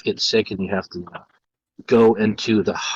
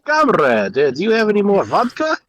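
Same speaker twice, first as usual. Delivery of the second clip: mock Russian accent; 10 semitones higher than usual. Joking with friends about the Metro game, hammy Russian accent. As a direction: play a boozy Soviet comrade asking for vodka.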